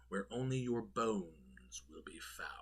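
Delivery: breathy unvoiced whisper of a threat; spooky whisper. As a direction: whisper the last words like a ghost story. Spooky ending of product description.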